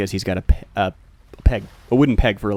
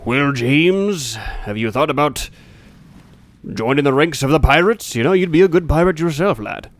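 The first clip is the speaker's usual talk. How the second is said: higher, put-on old-pirate voice; pirate jailer; 4 semitones higher than usual. Peg-legged pirate tempting his prisoner to join the crew, pirate voice. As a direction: coax the prisoner like a pirate recruiter. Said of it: "Thomas Pegleg comes up to you and he's like".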